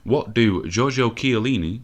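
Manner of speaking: hammed-up announcer read. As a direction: present the ad break like a game show host. Ad break announcer voice.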